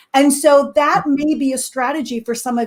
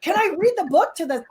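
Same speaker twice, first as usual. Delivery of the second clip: eager, higher-pitched request; so excited; 5 semitones higher, 4 dB quieter than usual. Retold or imitated. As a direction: re-enact an excited plea. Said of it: "I was so excited".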